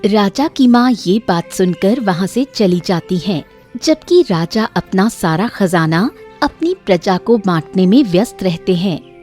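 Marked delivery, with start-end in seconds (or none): none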